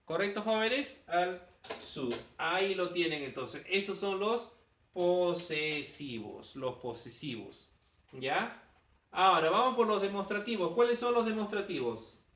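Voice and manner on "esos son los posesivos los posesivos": slow emphasis. Shown as a demonstration. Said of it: "teacher stressing the term".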